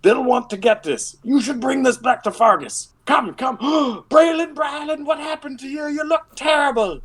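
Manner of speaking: put-on high, excited Irish accent; Irish dwarves. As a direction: gush over Braylon in an Irish lilt. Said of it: "all of a sudden they go Irish".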